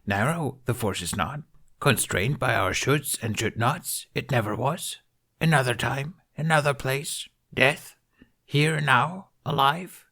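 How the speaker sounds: an ancient master gently correcting the council; Yoda voice. A narrator's reading.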